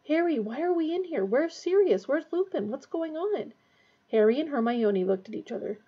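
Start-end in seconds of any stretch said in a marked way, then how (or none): 0.1-3.5 high, groggy confusion; bewildered questioning. A narrator's reading. Ron waking and asking Harry what happened, bewildered. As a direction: fire off questions in a raised, confused voice.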